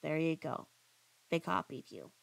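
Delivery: ordinary talk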